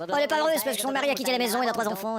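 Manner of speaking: deep voice. Acted. Infomercial joke, deep fast voice.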